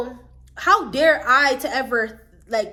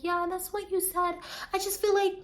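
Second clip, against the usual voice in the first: high, mocking girlish whine; mocking Aaliyah; 9 semitones higher than usual. Mocking Aaliyah's excuse to her face in a high, whiny put-on voice. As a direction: imitate her weak excuse, higher. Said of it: "be like".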